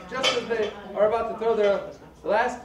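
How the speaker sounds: ordinary delivery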